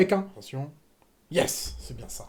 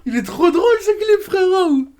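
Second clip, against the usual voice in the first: high, loud laughing speech; 10 semitones higher, 6 dB louder than usual. Real, not acted. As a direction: talk through laughter. Still laughing at the clip.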